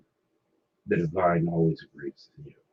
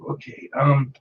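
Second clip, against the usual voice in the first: tipsy; 10 semitones higher than usual. Real, not acted. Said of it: "I'm a little tipsy at the moment".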